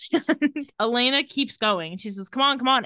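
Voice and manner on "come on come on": louder, higher retold urging; mock urgent pleading. Urging a dying patient during compressions, mock urgent. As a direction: imitate someone willing a patient back.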